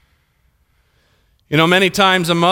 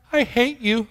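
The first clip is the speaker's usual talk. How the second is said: higher, softer hint of a small child; lightly voiced little girl; 5 semitones higher, 5 dB quieter than usual. Small daughter tells driving dad, softly childlike. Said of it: "she was about three or four years old".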